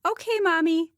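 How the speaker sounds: put-on high, lilting little girl; singsong it like a happy child; sing-song. A narrator's reading. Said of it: "in a sing-song voice".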